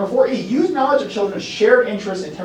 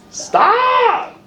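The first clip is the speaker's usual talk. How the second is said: put-on high piercing child shout; child shouting stop; 5 dB louder than usual. Teacher acting out a toddler's high shout. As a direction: shriek it like a toddler. Child voiced being tickled.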